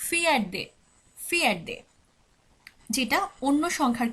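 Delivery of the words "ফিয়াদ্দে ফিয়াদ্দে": ফিয়াদ্দে said twice as Swedish "fi-a-de", each take falling; Swedish pronunciation demo. Shown as a demonstration. Teacher repeats the Swedish fourth for students, careful pronunciation. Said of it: "fourth কে বলা হচ্ছে".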